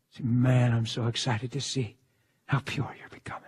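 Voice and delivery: ordinary talk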